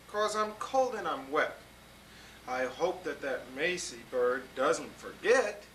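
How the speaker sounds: put-on lively read-aloud to children; storybook reading. A narrator's reading. Grown-up reading a picture book to kids, lively storytelling.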